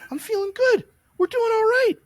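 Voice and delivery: high, cheery, self-assured voice; cocky upbeat quote. Retold or imitated. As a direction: sound way too pleased with yourself. Confident recovering self, acted quote.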